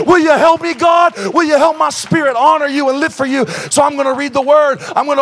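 loud, high, rising sermon shout; roaring revival preaching; full-force preaching. Real, not acted. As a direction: preach it with all your fire.

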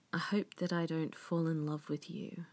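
sultry whispered title; femme fatale. Acted.

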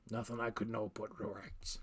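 soft whispered reassurance; whispering. A narrator's reading. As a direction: breathe it out in a whisper. Healer's quiet aside.

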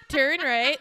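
loud navigation-assistant impression with a laugh; giggly mock sat-nav; Siri voice. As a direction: announce it like a GPS. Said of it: "You should be a Siri voice".

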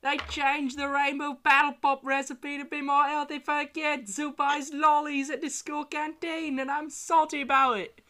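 high, loud, exaggerated Australian accent; terrible Australian accent. Reading podcast topics to friends, terrible Australian accent. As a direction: read it out in a badly faked Australian accent. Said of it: "reading the topics in terrible Australian accents".

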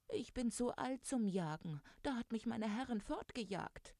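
high-pitched, mildly tearful cat voice; storybook mock sobbing. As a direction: play a weepy cat, pitched well up. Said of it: "schluchzte die Katze".

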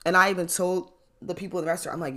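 usual speaking voice

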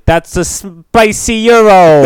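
loud, high, drawn-out fake Greek accent; mock Greek accent. Host showing what to yell, in a loud mock Greek accent. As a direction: belt it slowly in a hammed-up Greek accent. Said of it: "in your best Greek accent".

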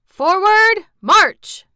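shouted. A narrator's reading. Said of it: "shouted the majors".

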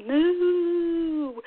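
high, long-drawn playful moo; sing-song cow moo for a child; animal sound moo. Shown as a demonstration. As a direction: moo slowly with the vowel held. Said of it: "those animal sounds".

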